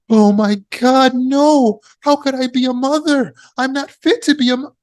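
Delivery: gasp, then high-pitched, melodramatic wail; hammed-up panic, voice held very high; horrified self-doubt. Shown as a demonstration. Speaker acting a dismayed mother doubting herself, horrified and panicky. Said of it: "Should you say".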